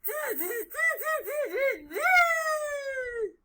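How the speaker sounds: long, high "tsz tsz" chittering run; squeaky excited monkey noise; monkey chatter. A narrator's reading. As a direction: chatter mockingly, stretching the last squeal. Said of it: "and said".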